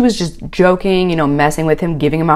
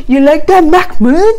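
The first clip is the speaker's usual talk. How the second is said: You like that pushed higher and louder; louder mock taunt; 7 semitones higher, 6 dB louder than usual. Nicole taunting Mahmoud again, higher and louder mock taunt. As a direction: crank up the teasing on You like that, Mahmoud. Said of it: "Nicole's like".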